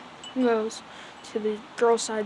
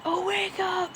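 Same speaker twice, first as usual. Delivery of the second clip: mock screaming; 9 semitones higher than usual. Friends waking sleeping Midoriya, high mock scream. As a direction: imitate a group yell in a high voice. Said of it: "Screaming at Midoriya".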